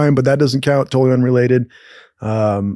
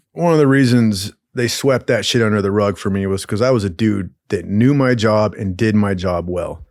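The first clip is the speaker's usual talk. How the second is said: usual speaking voice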